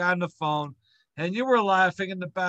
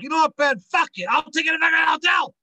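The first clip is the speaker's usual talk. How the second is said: loud, higher, fast angry shout; angry outburst; 9 semitones higher, 7 dB louder than usual. Retold or imitated. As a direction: blow up and storm off. Angry brother storming off to hotel.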